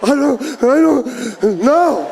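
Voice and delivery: put-on slow, timid little boy; meek kid. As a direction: mumble it like a scared child. Scared kid answer.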